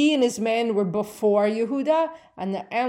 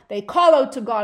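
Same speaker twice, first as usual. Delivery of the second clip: ordinary talk, nothing marked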